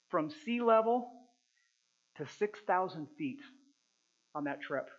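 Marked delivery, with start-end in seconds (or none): none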